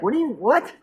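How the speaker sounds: stammered high-pitched disbelief; retold bafflement. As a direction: act out being utterly baffled, voice jumping up. Acts out his own baffled reaction.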